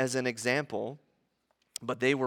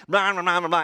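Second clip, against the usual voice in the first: louder, higher blah-blah grumble; hammed-up grumbling babble; mock grumbling; 4 semitones higher, 5 dB louder, faster than usual. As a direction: run the words together as imitation griping. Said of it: "we just grumble and grumble".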